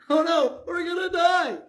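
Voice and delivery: hammed-up terror, sure they are doomed; freaking out. Gehazi yelling to Elisha that they will die, panicked. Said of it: "he's freaking out".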